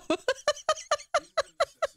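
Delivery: bright giggle, pitched high; high amused laugh. Real, not acted. Laughing at chat's reaction.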